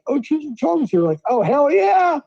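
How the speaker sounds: excited. Retold or imitated. Retelling excitement at the dispensary.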